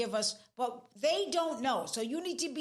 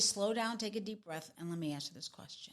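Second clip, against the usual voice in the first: low voice, sinking much softer as it goes; calming down a partner; 5 semitones lower, 6 dB quieter than usual. Shown as a demonstration. Coach modelling a reply to an irritated partner, calm and hushed. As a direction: settle them gently, growing quieter. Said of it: "Then, then say".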